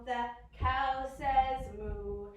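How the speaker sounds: slow sing-song cow sound; slow animal sounds. Shown as a demonstration. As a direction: moo drawn out slowly. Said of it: "very first animal".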